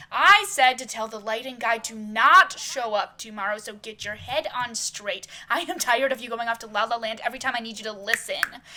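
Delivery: loud, cutting-in reprimand; snapping angrily. Acted. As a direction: cut him off and tell him off loudly. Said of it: "snapping right in his face".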